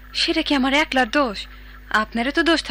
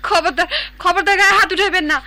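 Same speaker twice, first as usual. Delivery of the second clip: raised, indignant voice fending off a blow; higher-pitched, defiant protest; angry warning; 5 semitones higher, 4 dB louder than usual. Acted. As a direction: warn him off fiercely. Wife warns husband off hitting her.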